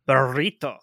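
"burrito" with excessively rolled r; exaggerated Spanish pronunciation. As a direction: imitate Mother over-rolling the r in "burrito". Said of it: "most excessively rolled, uh, accentuated".

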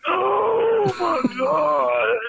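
mock relieved cry. Recruit relieved at last, hammed-up cry.

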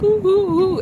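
mock child's scared oohs; frightened whimpers. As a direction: whimper like a terrified little kid. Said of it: "And I'm just like".

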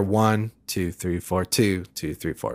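"one" and the second bar's opening "two" stand out, the other beats soft and low; accented downbeats. Shown as a demonstration. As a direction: count two bars, stressing each first beat and murmuring the rest. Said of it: "If I've got an accent".

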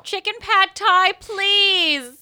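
1 mock order. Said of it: "mock ordering food".

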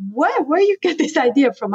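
high, incredulous mock voice of the grown-ups; imitating parents who can't believe it; parents' disbelief. Mocking her parents' shocked reaction to her dream. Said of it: "and they were like".

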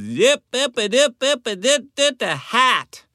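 high, fast garbled drawl, barely words; thick Southern accent. Retold or imitated. Rental car clerk calling from thirty feet away, high thick accent. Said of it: "she had a very thick accent".

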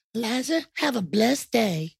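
high, soft singsong farewell; hammed-up high Southern sign-off; mock well-wisher. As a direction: wish it sweetly in a put-on voice. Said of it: "nobody would ever say".

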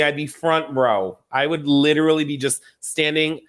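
ordinary talk, nothing marked

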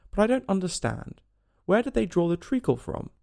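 high, cautiously puzzled question. A narrator's reading. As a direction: ask carefully, not wishing to offend. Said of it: "she began very cautiously".

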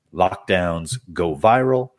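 ordinary talk

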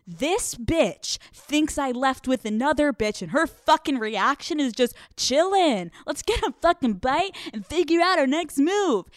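high-pitched incredulous rant; imagined amazement. Retold or imitated. His amazed thoughts, voiced by teller.

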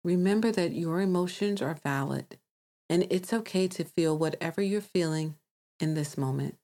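calm meditation voice with long pauses; guided meditation. Acted. Host guides listeners to release emotions, calm meditation voice. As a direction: guide them gently, leaving space to breathe.

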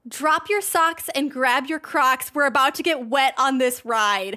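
slow, emphatic quote reading; declaimed movie line. Retold or imitated. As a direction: draw out each word like a big punchline.

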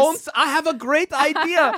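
mock German accent, laughing through it; a hammed-up German officer; comic fake German accent, high and giggly. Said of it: "I can't do a German accent".

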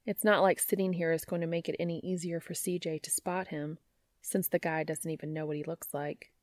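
usual speaking voice

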